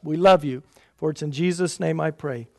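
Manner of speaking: ordinary talk, nothing marked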